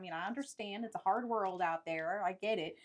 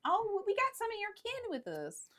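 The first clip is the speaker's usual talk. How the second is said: lightly voiced traveller, higher in pitch; mock traveller; 8 semitones higher, 10 dB louder than usual. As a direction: bargain with the bandit in a put-on voice. Player voices a traveller character.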